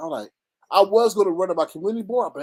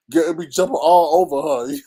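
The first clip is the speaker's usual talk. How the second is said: normal talking voice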